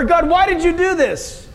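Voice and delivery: high, frustrated questioning of God; exasperated complaint to God. Retold or imitated. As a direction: demand an answer from God. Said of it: "How many times are we tempted to say".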